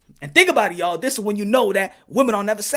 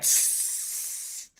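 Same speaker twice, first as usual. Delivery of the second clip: unvoiced tss hiss; a short sly hiss; snake hiss; 16 dB quieter than usual. Snake hissing at Eve before advice, unvoiced.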